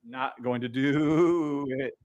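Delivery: ordinary delivery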